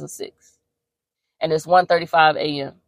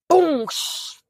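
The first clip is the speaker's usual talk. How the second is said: mimics a crash, higher and in a put-on voice; imitated crash; 10 semitones higher than usual. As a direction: spit out the crash like a sound effect.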